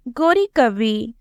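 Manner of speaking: ordinary delivery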